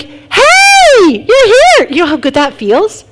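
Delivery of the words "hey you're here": high, loud, delighted "hey, you're here"; joyful greeting. Retold or imitated. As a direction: welcome a friend with open arms. Greeting in heaven, calling out.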